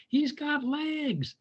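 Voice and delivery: high and slow, drawn out; excited news. A narrator's reading. Tudley tells the frog mother, high and slow. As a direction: stretch it out with delight. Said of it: "he said".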